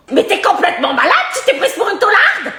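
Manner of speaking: ordinary talk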